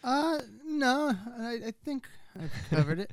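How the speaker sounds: croaky, dying voice; croaked out. Real, not acted. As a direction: croak the words out weakly. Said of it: "a dying Christopher Lloyd".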